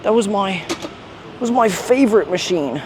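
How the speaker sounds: lightly mocking old gym bro; mocking a boomer. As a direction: play a gym boomer reminiscing. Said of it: "All the boomers gonna be like".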